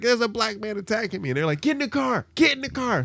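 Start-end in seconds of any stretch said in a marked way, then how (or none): 0.0-1.3 mock frantic lady, pitched way up; high panicky lady voice. Lady voiced calling police. 1.6-3.0 frantic high-pitched hurry; urgent high yelling. Retold or imitated. Friends urging, voiced in story.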